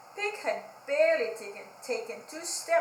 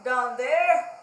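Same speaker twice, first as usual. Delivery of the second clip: calling down; 9 dB louder, much slower than usual. Acted. Pig on stilts calling down to Zebra. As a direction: call down to someone far below. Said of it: "they called to Zebra".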